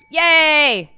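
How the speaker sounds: high, loud drawn-out yay; playful cheer. Pretend. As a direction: let out a big gleeful cheer. Cheering between story turns.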